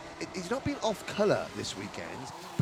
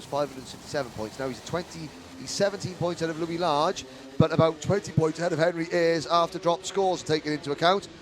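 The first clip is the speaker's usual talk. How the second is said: usual speaking voice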